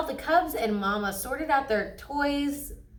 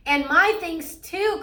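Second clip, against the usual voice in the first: higher, louder protest; crying out; 8 semitones higher, 5 dB louder than usual. A narrator's reading. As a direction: call it out indignantly. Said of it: "cried brother".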